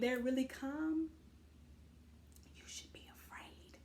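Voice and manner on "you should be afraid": ominous whispered warning; spooky whisper. Acted. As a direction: breathe it like a threat. Warning about calm people.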